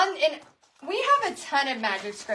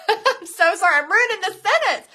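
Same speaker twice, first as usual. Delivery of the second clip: laughing through a louder, higher sorry; laughing apology; 7 semitones higher, 7 dB louder than usual. Real, not acted. Host laughs at botching a fan's quote, laughing. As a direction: giggle while apologizing for the slip. Laughs at her own misreading.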